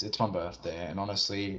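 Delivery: normal talking voice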